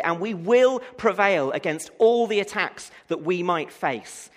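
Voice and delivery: loud, rising pulpit preaching, hammering key words; rally the congregation with fervour; full-force preaching. Acted.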